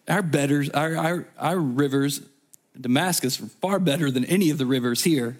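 ordinary talk, nothing marked

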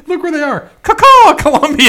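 very high, loud laughing cry; laughing squeal. Real, not acted. Laughing at the town name.

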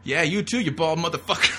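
laughing heckle. Pretend. Joking viewer taunts a bald moviegoer, laughing loud shout. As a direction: yell the insult while cracking up.

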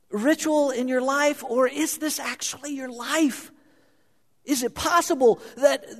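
high, slow pleading, hushed then building to a cry; Jesus pleading. Acted. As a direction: plead with them to wake from comfort. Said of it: "I can hear him saying".

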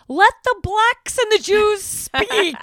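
high, drawn-out mock shout; mock yelling. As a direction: imitate her yelling, loud and slow. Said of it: "And then Sonia yelling".

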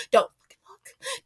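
slow, fierce whisper; whispered warning. Retold or imitated. Mother warning the surgical team not to look, slow fierce whisper. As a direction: hiss it low so only they hear.

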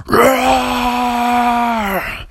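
big high roar; dinosaur roar. Acted. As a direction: roar like a freed T-Rex. T-Rex roars into sky.

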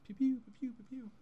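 high loud laser pew sounds; jump cut noises. Pretend. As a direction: fire off cartoon laser zaps. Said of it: "jump cut noises".

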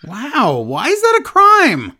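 high, loud, drawn-out protest; mock outrage. Co-host protests the joke about the accent, mock outraged. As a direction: play up indignant disbelief.

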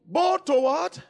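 slow, loud drawn-out preaching call; preaching shout. Real, not acted. Preacher booming the verse to the congregation slowly.

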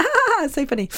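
very high, soft giggly voice; childish laughing squeal; giggling kid. Pretend. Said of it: "in the end, they're like".

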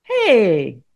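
sharp loud "Hey" from across the bus; loud call. Retold or imitated. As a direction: call out to stop a fight. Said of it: "this voice that comes out of nowhere".

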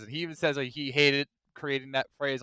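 ordinary delivery

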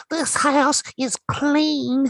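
put-on high, slow, tiny old medium voice; very high slow little psychic lady; Tangina voice. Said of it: "the little tiny munchkin lady who says".

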